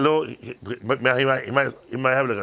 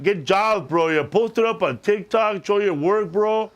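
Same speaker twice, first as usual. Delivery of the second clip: put-on higher, eager young bro voice; J-Rod voice; 3 semitones higher than usual. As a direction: hype it up like an Instagram electrician kid. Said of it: "it's a kid on Instagram named J-Rod".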